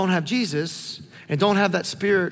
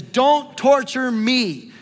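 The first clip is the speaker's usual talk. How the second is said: loud, high, drawn-out plea; raised voice, each word stretched; demon's cry; 5 semitones higher, 5 dB louder, much slower than usual. Acted. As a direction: cry it out slowly and forcefully. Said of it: "the demon says".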